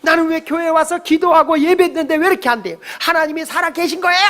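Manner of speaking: high-pitched indignant grievance, acted out; argumentative complaint. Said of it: "막 이러면서 따지는 인간들이 있어요".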